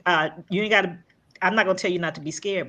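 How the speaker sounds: ordinary delivery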